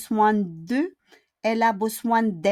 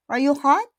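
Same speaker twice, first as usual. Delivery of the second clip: "Are you hot?" higher and louder, rising on "hot"; rising question tune; 7 semitones higher, 6 dB louder, faster than usual. Shown as a demonstration. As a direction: swing up on "hot". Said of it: "we need to go up with your intonation". (pataas ang boses sa "hot")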